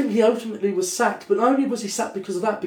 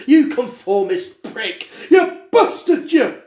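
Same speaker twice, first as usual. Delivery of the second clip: mock outrage; 5 semitones higher, 6 dB louder, slower than usual. Mock insults to cohost.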